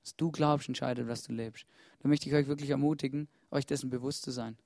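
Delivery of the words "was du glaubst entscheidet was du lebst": quiet. Acted. Same line repeated, second take.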